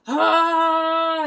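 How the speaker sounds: high, loud mock shriek; chaotic psychopathic yell. Imitating the singer's chaotic energy with a crazed shriek. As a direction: let out a crazed villain cry. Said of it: "psychopathic almost energy of like".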